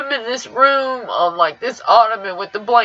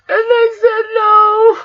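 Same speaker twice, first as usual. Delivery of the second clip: crying; 10 semitones higher, 5 dB louder than usual. Real, not acted. News of the death.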